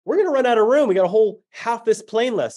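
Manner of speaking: high, thrilled disbelief; reading the cards and thrilled they're running out of room; excited. Retold or imitated. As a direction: bubble over with excitement. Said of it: "I'm like".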